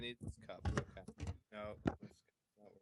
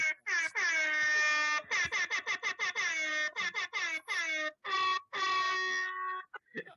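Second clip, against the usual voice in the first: loud, high, helpless howl of laughter; 10 semitones higher, 6 dB louder than usual. Real, not acted. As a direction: lose it completely, laughing loud and long. Friend cracks up at iron plot.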